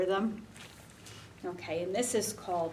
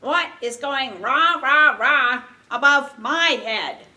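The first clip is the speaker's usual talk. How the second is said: loud, raised storybook crow; 4 semitones higher, 10 dB louder than usual. Acted. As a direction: call it out loudly like a crow. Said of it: "And the crow says".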